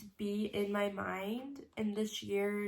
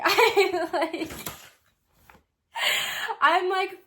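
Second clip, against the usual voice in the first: high, loud laughing on the verge of tears; teary laugh; 9 semitones higher, 8 dB louder, much slower than usual. Real, not acted. Vlogger laughing nervously before reading her journal. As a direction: laugh through rising tears. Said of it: "hopefully I don't start crying".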